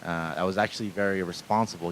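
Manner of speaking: low, subdued voice of thinking to oneself; inner monologue. Acted. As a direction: murmur it like a private thought. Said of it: "just thinking in my head".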